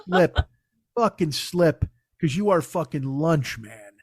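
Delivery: low, muttered scheming dog; will the squirrel to fall, low and hungry. Retold or imitated. Dog staring at the squirrel, low scheming mutter. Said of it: "the dog is just going".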